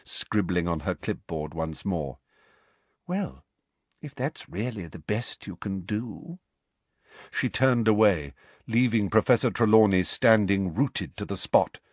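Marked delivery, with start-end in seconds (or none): 3.1-6.4 quiet dismissive. Acted. Umbridge dismisses Trelawney.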